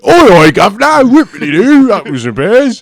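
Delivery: loud, high, hammed-up cockney; mock cockney. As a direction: overdo a cockney geezer. Mock cockney impression in banter.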